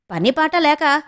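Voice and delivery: grandmother's fed-up retort; irritated. Acted. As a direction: brush it off with annoyance. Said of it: "చిరాగ్గా అంది మంగమ్మ".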